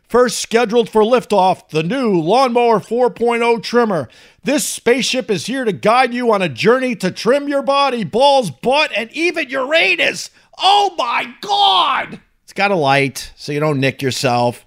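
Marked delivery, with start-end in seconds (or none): 0.1-10.3 higher-pitched space-launch announcer read; dramatic ad read. Acted. 10.6-12.2 high, drawn-out mock astonishment; mock shock. Mock shock at ad's pun.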